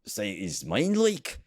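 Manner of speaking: high-pitched mock Scottish accent; hammed-up Scottish impression with swooping pitch. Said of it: "this is Scottish accent".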